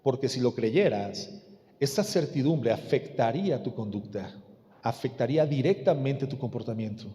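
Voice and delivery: low, soft, measured pulpit voice; hushed solemn preaching. Real, not acted. Preacher admonishes children about supporting parents, hushed solemn preaching. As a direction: bring it right down, gravely.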